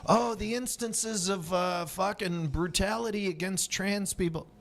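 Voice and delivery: mocking activist voice. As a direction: mimic a whiny activist.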